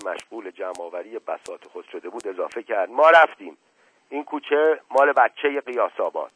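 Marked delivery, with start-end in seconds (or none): 2.9-6.3 loud, higher, aggrieved announcement; huffy parting shot. A narrator's reading. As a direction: throw it back loudly as you leave. Shoeshiner leaves in a huff. (کنایهٔ بلند و دلخورانه)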